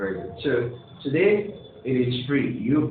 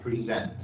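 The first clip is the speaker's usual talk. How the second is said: "present" as a verb, pre-ZENT; second-syllable stress; 6 dB quieter than usual. Shown as a demonstration. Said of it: "Good, the second syllable".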